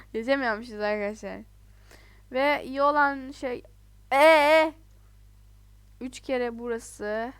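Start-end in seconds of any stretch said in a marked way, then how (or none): 4.1-4.7 sudden high loud yelp; startled yelp. Real, not acted. Jumping onto glass, a sudden yelp. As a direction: blurt out a sharp cry.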